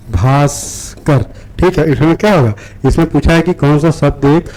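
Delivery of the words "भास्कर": भास्कर said very slowly, syllable by syllable; slow spelling read. Shown as a demonstration. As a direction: stretch out भास्कर so every sound is clear. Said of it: "धीरे-धीरे वर्तनी पढ़ना". (भास्कर को बहुत धीरे-धीरे, एक-एक अक्षर बोलिए)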